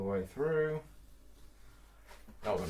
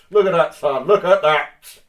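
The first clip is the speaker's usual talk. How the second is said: loud, proud showing off; triumphant boast; proud shout; 5 semitones higher, 15 dB louder, slower than usual. Real, not acted. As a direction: bellow it out to your son. Proud butcher showing off steak.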